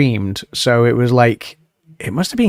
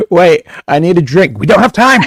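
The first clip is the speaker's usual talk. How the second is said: impatient mock friend, rising and louder; imitating Pam; 5 semitones higher, 6 dB louder than usual. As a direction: play the hurried friend in a pushy, hammed-up way. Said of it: "Pam will be like".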